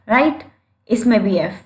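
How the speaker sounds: ordinary talk, nothing marked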